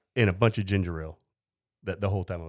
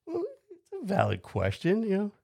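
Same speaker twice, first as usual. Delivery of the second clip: mock innocent defense; 11 semitones higher than usual. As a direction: act wide-eyed and reasonable, pitched up. Defending his marijuana question.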